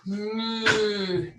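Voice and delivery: low, soft moo; low cow sound. Shown as a demonstration. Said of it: "And cow".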